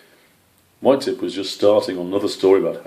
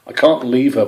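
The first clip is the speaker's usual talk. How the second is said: firmly; 5 semitones higher, 6 dB louder than usual. A narrator's reading. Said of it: "Brian said firmly".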